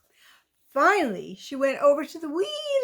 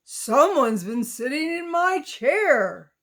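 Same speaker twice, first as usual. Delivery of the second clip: lightly gruff bear roar; Papa Bear roar; 3 semitones higher than usual. Acted. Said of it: "roared in his great big voice".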